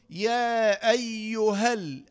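long "يا", short "ها" straight into l of "ال"; long madd, dropped vowel. Shown as a demonstration. Teacher showing the dropped vowel, slow "يا أيها ال" joined. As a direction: hold "يا", clip "أيها ال" into the lam. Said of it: "إلى اللام الساكنة مباشرة".

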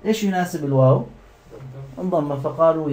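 usual speaking voice

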